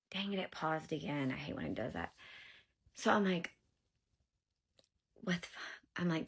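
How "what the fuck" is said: soft, breath-only disbelief, acted out; stunned whisper. Mother reacts to the worker's claim, stunned whisper. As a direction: whisper it as if to yourself. Retold shock, said to herself.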